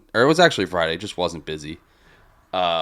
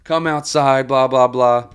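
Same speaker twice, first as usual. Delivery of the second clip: higher-pitched blah-blah imitation; dismissive mock taunt; 4 semitones higher, slower than usual. Rowdy woman challenging bartender, dismissive mock taunt. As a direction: mimic her taunts as tiresome noise.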